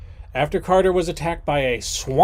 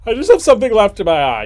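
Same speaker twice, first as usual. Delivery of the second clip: fast, higher, louder insisting; mock denial; 8 semitones higher, 8 dB louder, faster than usual. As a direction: brush it off in a rush, hammed up. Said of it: "it's called I'm Not Crying".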